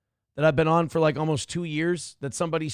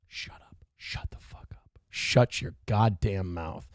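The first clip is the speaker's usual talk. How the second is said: whispered self-rebuke, rising to a low mutter; hushed telling-off of himself, very soft at first; scolding himself; 10 semitones lower, 5 dB quieter than usual. Real, not acted. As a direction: whisper it to yourself, then slow and low. Scolding himself under his breath.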